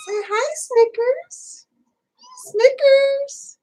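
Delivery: very high sing-song pet talk; cooing to kitten. Real, not acted. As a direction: coax a kitten in a squeaky-high, drawn-out coo. Talking to her foster kitten.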